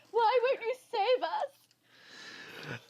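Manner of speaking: high, slow, squealing plea; making a scene on the dock, squealy and pleading. Acted. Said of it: "In a very squealy voice".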